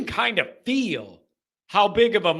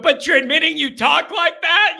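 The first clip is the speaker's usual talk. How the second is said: ordinary delivery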